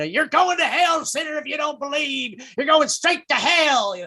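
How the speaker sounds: high-pitched mock hellfire shout; hammed-up damnation, pitched high; screaming old evangelist. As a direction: imitate a ranting radio preacher. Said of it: "those old evangelists screaming at you".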